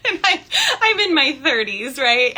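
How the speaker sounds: real giggles through the line; amused laughter; laughing. Real, not acted. Laughing at herself.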